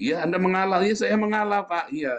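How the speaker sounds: high-pitched show of giving in; mock yielding. Acting out insincere giving in. (pura-pura mengalah dengan suara tinggi)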